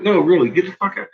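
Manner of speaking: high, fast, loud plea to get out; fast, pushy plea to a friend to leave; urgent warning. Retold or imitated. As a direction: rush the plea as if the danger is real.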